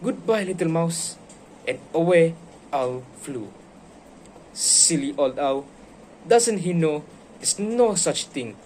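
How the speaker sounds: put-on lively read-aloud for kids; animated bedtime story voice; storybook reading. A narrator's reading. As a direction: read it like a picture book to a class. Teacher reads The Gruffalo aloud.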